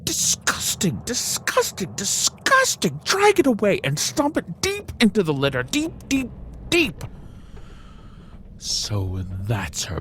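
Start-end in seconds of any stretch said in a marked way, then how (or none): 0.0-3.0 high, repeated disgust. Acted. Bunny rejecting mustard greens, disgusted. 3.0-7.0 high, rising frantic command; bury it, bury it; fierce. Acted. Bunny banishing the greens to the litter, frantic.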